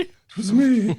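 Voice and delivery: high, loud hammed-up confession; mock confession. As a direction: chime in with a silly high voice. Joins mock Franklin confession.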